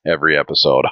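ordinary talk

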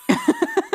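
sharp high giggle; high laugh. Real, not acted. Laughing at own joke.

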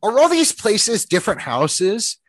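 high-pitched imitation of her exasperation; frustrated. Imitating the delivery driver pointing at the apartment building, frustrated. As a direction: mimic a frustrated, baffled question. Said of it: "she got really frustrated".

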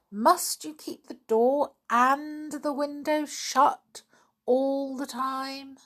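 high, slow, drawn-out disbelief; exasperated mother stretching every word; exasperated complaint. A narrator's reading. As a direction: pitch held high, slowly incredulous. Mummy complains about stuffy shed.

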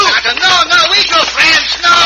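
high, loud frantic no; frantic protest. Acted. Pancho refusing the bandits mid-brawl, high and frantic. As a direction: wave them off in a panic.

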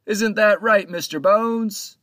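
high, loud call aloft, slowing on the name; captain calling up to the crow's nest. A narrator's reading. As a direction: hail the lookout from the deck. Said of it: "called out".

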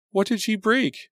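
high, loud anxious question. A narrator's reading. Best friend anxiously asks the medics, high and loud. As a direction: press the medics, worried sick.

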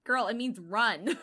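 slow, loud emphatic warning. Pretend. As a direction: drive home that she should run. Urgent warning to heroine.

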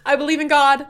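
playing the nervous daughter blurting it out; daughter confessing. Daughter confessing to her mom, high loud childlike voice. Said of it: "And she's like".

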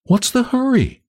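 put-on high, girlish teasing question; soft-voiced girl. A narrator's reading. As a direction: lightly call down to him. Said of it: "said the soft voice".

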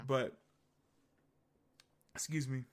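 hiccuping between words; hiccups. Real, not acted. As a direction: speak through the hiccups. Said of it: "Got the hiccups".

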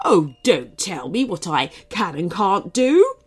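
lightly voiced bossy cupcake; restrained shout in a character voice; indignant mock shout. A narrator's reading. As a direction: object with mild storybook outrage. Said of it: "shouted the cupcake".

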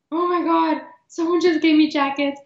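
high, tearful voice, reliving the sobbing; retold bawling. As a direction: let it crack up high as if crying again.

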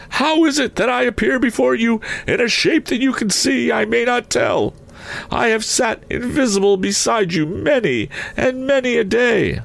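very high, wailing phantom voice; mournful ghost. A narrator's reading. Ghost refusing comfort to his old partner, mournful high voice. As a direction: intone it high as a restless spirit.

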